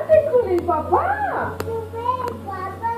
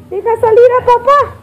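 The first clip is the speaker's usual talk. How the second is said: fast, a bit louder coaxing; hurried urging; 4 semitones higher, 4 dB louder, much faster than usual. Real, not acted. Mother hurrying the toddler to let papa out, fast coaxing. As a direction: rush her along in the game.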